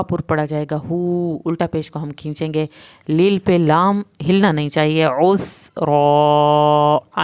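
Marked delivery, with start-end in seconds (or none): 5.0-7.2 long drawn ع and heavy را in عسرا; throaty ain. Shown as a demonstration. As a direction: say the ع of عسرا from the throat, full-mouthed را. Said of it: "آئین کو حلق سے پڑھنا ہے".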